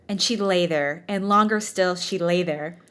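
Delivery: normal talking voice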